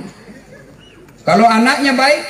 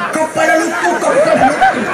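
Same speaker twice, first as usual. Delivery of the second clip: put-on excited kids' shout; 7 semitones higher than usual. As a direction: call out at the plane overhead like a thrilled village kid. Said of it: "Bahasa Bugis".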